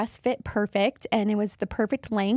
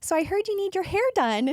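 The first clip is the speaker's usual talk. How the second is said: hairdresser's voice; 9 semitones higher than usual. Retold or imitated. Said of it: "this lady comes out and she goes".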